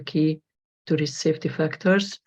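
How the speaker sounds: ordinary talk, nothing marked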